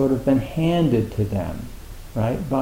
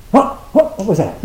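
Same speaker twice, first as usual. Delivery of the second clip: higher, stammered double take; acted startled surprise; 7 semitones higher than usual. Meditator suddenly hitting blankness, acting startled. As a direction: blurt it as if caught off guard.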